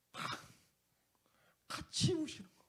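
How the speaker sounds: high, soft tearful break. Real, not acted. Pastor moved to tears recalling Jesus' embrace, voice high and soft. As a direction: let the voice crack into tears.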